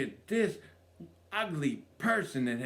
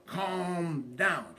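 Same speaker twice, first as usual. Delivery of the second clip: a higher, lighter coaxing calm down; 4 semitones higher than usual. Retold or imitated. Husband repeating his plea to his wife, lighter and higher. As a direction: coax her, a touch higher.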